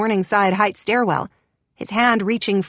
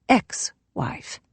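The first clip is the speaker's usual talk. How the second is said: terse whisper; 4 dB quieter than usual. A narrator's reading. Curt correction.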